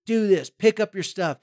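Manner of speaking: put-on a frustrated parent snapping at a messy teen; frustrated parent nagging. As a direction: bark out chores like a fed-up parent. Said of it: "they just say".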